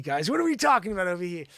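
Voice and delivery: ordinary talk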